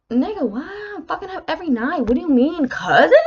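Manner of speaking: high-pitched mock disbelief; exasperated, can't believe what was said; incredulous outburst. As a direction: protest in high-voiced bafflement, landing hard on the last word. Reacting to being taken for cousins.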